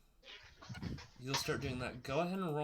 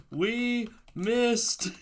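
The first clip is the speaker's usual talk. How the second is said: excited shout; 10 semitones higher, 11 dB louder, much slower than usual. Real, not acted. Greeting an arriving friend.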